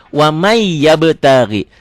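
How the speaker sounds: "Wamai yabtagi" slow, long doubled Y; merged recitation. Shown as a demonstration. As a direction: hold the glide from "Wamai" into "yabtagi". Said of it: "N-nya berlebur jadi Y".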